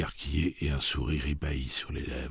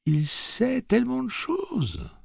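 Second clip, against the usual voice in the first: put-on high, slow, marvelling boy; boy's awed voice; slower than usual. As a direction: say it in wonder. Jake marvels.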